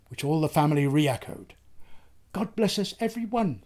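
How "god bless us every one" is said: put-on small, soft child's voice, a bit higher; a hint of a frail little boy, softly; Tiny Tim. A narrator's reading. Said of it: "said Tiny Tim".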